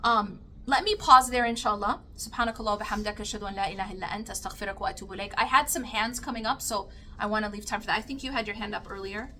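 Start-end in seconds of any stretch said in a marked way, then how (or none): 2.2-5.3 low, quick murmured Arabic recitation; closing prayer. Real, not acted. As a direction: recite softly and rapidly, almost under your breath.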